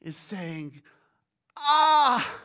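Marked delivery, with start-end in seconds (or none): 1.6-2.3 loud groan of an overwhelmed prophet; acted-out aching ah; painful groan. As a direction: groan as if your head hurts.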